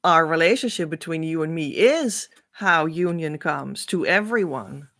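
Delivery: ordinary delivery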